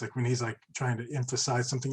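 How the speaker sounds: normal talking voice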